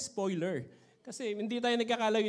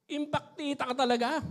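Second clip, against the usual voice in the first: mother scolding; 8 semitones higher, 4 dB louder than usual. Retold or imitated. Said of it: "sabi ganun nung nanay".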